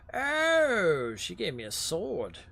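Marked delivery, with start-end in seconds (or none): 0.1-1.1 sudden high loud oh; shocked yelp of surprise; surprised high oh. Real, not acted. Sees the sword he was given.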